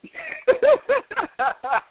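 very high peal of laughter; laughing. Real, not acted. Laughs mid argument.

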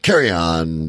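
ordinary talk, nothing marked

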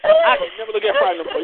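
squealing in mock despair; high exasperated cry. Real, not acted. Host wailing to his audience about the Friday joke, high and loud. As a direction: wail it out high and loud, half laughing. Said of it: "reacting to the nickname".

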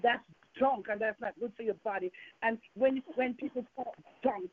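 ordinary delivery

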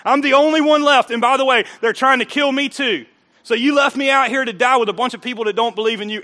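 loud, high accusing complaint; aggrieved Elijah. Retold or imitated. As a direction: blame God for abandoning him.

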